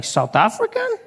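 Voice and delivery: high-pitched disbelief. Retold or imitated. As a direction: question it as if it cannot be true. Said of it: "He's like".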